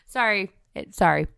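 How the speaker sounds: ordinary talk, nothing marked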